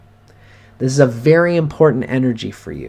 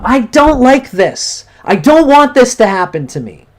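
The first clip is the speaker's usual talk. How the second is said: angry yelling; 10 semitones higher, 6 dB louder than usual. Shown as a demonstration. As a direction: pour out the frustration, demanding to be heard. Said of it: "And you punch the pillow".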